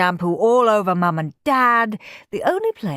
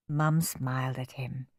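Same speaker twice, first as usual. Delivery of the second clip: ordinary delivery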